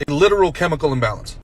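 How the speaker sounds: ordinary talk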